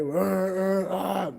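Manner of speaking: mock angry noises. Mimicking a driver who got cut off, mock angry noises. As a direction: imitate a fuming driver's wordless grumbling. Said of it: "Why get mad, right?".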